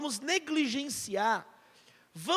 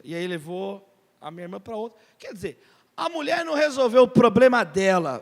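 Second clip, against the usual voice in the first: ordinary delivery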